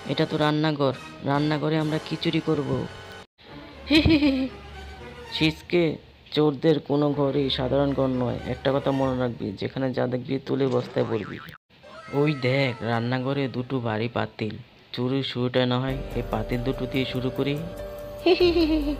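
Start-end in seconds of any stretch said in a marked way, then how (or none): 3.9-4.5 sneaky giggle. Acted. Thief giggles in kitchen. 18.2-18.9 sneaky giggle. Acted. Thieves giggle over pots.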